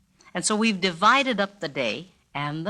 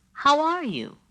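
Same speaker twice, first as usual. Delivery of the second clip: concerned greeting; 9 semitones higher, 3 dB louder than usual. Shown as a demonstration. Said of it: "there was concern in the inflection".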